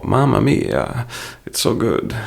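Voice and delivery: high, drawn-out mock Italian exclamation; hammed-up Italian accent, savouring it; bad Italian impression. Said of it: "bad Italian impression".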